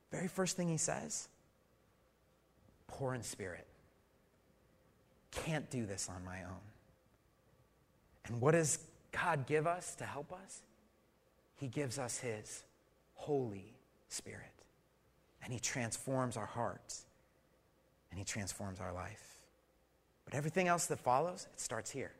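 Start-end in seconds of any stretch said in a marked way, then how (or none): 2.9-6.6 hushed reflection. Acted. Preacher softens into quiet reflection. 11.6-19.4 hushed reverent preaching. Acted. Quiet, slow reverent preaching.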